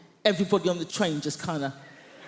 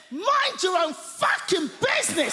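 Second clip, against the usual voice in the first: a long-married husband ranting at his wife; grumpy old husband; 10 semitones higher than usual. Retold or imitated. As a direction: vent decades of pent-up fury. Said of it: "he's behind that newspaper going".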